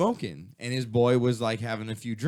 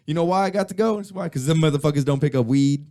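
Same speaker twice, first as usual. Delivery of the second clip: louder, higher cocky punchline; cocky retold punchline, louder and higher; punchline bravado; 5 semitones higher, 7 dB louder than usual. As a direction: deliver it like a triumphant joke. Chappelle punchline voiced loudly.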